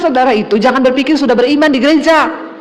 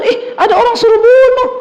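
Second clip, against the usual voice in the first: much higher, sly squeal of delight; gleeful devil voice; 11 semitones higher than usual. Retold or imitated. Preacher voicing a delighted devil who hears someone ask to be killed, high and sly. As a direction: squeal like a devil finding prey.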